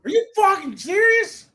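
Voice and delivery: high, loud disbelieving outburst; can't believe what he just heard; incredulous. Real, not acted. Co-host reacting to the Dom belt pitch, incredulous.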